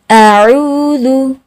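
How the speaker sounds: अऊदु drawn out slowly, syllable by syllable; slow drilled recitation. Shown as a demonstration. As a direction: stretch अऊदु long and even. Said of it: "अब मेरे साथ मशक करें". (अऊदु को धीरे खींचकर)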